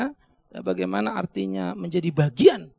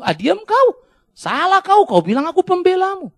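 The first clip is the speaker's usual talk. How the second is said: God's rebuke; 10 semitones higher, 7 dB louder than usual. Retold or imitated. God rebukes wrongdoer.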